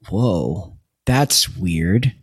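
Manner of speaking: low, flat mock whoa; deadpan surprise. Reacting to the Queens line, low mock surprise. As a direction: drop it low like a stunned dude.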